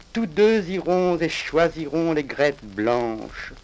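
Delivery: ordinary talk, nothing marked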